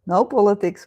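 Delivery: ordinary delivery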